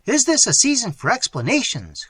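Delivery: high, loud impatient outburst; impatient cry. Acted. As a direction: cut him off, loud and high. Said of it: "cried Theodore".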